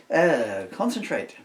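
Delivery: loud, high order to oneself; exasperated outburst to refocus; self-scolding. Real, not acted. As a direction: pull yourself together, loudly.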